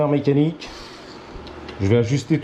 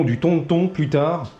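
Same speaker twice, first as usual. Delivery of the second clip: ordinary talk, nothing marked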